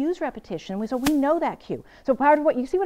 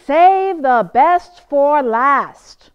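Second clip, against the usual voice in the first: very slow, loud, ringing proverb; booming proverb for the class; proverb declaimed; 3 semitones higher, 12 dB louder, much slower than usual. Shown as a demonstration. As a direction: proclaim it like a grand saying. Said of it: "I'm gonna tell you the proverb".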